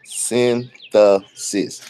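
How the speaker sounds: very slow, careful "Synthesis", every syllable drawn out; deliberate slow "Synthesis"; said slowly. Shown as a demonstration. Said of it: "if I do it slow, I can do it".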